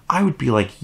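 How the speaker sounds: usual speaking voice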